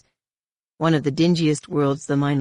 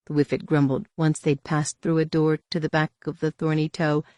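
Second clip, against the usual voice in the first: usual speaking voice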